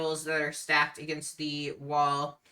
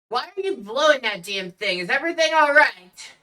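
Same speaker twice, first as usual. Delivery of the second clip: louder, higher, quick grumble of a bartender; grumbling bartender; 8 semitones higher, 9 dB louder, faster than usual. Acted. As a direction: complain loudly and fast to yourself as the bartender. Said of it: "He's just kind of, m-, uh, muttering".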